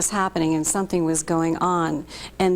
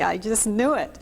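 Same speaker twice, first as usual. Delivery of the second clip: normal talking voice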